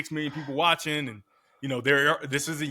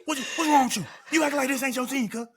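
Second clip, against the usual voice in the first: fast-talking teammate; 12 semitones higher than usual. Retold or imitated. Teammate Denard scolding the quarterback after an interception, high fast mock yell. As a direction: rattle it off high like an amped-up Florida teammate. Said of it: "he talk real fast".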